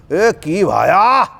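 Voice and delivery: slow, drawn-out Haryanvi greeting; teacher mimics a Haryanvi greeting, slow and drawn out in Haryanvi style; Haryanvi call. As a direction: stretch out a Haryanvi "એ કી ભાયા" like a hearty shout. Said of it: "કરીને જોરમાં બોલે".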